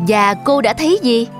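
plain reading voice

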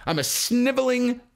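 hammed-up sniveling addict; mock sniveling addict. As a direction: whimper it pathetically. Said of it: "You know".

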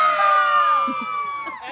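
very high, pretend terrified scream. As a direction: shriek as if seeing a ghost. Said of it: "all the disciples in the boat scream".

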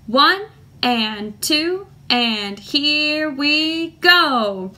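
slow, measured count-in on the beat. Shown as a demonstration. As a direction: stretch each word to set the tempo. Teacher counts the class in.

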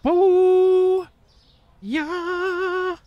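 loud, very high drawn-out whoop; long, high celebratory holler; whooping cheer. Real, not acted. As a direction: cheer in a soaring falsetto. Cheers as new lights go on.

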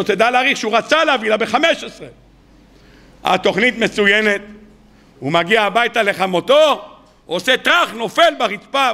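normal talking voice

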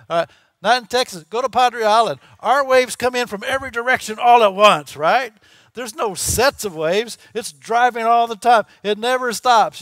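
loud, driving preacher voice, pushing each line up; full-force preaching. Acted. Preacher hammering home rough Texas waves, loud full-force preaching. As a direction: preach it hard and loud at the congregation.

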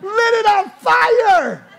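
high, loud, slow cheering shout; thrilled shout. Real, not acted. Preacher cheering Ben's burning shirt, shouting with delight. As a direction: cheer it out with a laugh. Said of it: "I'm thinking in my mind".

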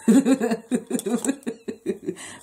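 high, rolling giggle; amused laugh. Real, not acted. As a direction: laugh at your own joke. Laughs at own smart-mouth joke.

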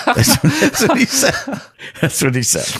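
laughing while repeating the punchline. Real, not acted. Telling the interviewer the joke, laughing. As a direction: chuckle through the words.

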